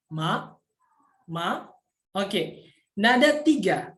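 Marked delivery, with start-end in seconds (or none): 0.1-1.7 MA as a rising Mandarin second tone. Shown as a demonstration. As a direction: start MA low and sweep it upward. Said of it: "nada rendah ke nada tinggi".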